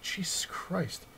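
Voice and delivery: slow, high, hushed "Jesus Christ"; a disbelieving breath at the price; stunned gasp. Retold or imitated. Retelling his shock at the price, slow and high.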